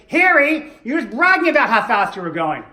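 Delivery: loud, high-pitched mock wife outburst; nagging wife. Wife in a joke tells on her husband to the cop, loud nagging.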